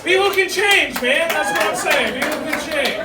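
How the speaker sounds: loud laughing callback; loud punchline. Real, not acted. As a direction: shout the tag over the laughs.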